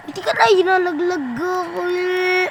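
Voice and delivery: high, whining half-sob; whiny cry. Real, not acted. Dropped by teammate, whines. (umiiyak na reklamo)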